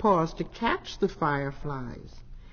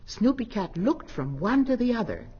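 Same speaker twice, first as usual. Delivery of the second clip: plain reading voice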